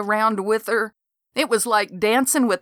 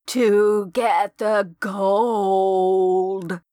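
ghost voice; much slower than usual. A narrator's reading. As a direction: let each word hang like a dead woman's voice. Ghost voice answers.

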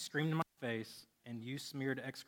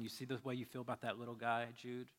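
soft, low fatherly reassurance; hushed, loving daddy's voice; tender God as father; 3 semitones lower, 7 dB quieter than usual. Acted. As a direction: speak gently, sinking quieter with each pause. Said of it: "He's saying".

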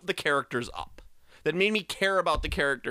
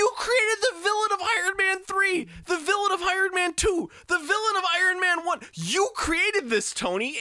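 ranting shout. Real, not acted. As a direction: yell the accusation at Tony. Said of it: "my animation".

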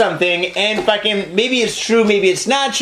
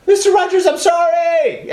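panicked apology shout; 10 semitones higher than usual. Retold or imitated. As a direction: yell it high and desperate. Acted apology after shooting.

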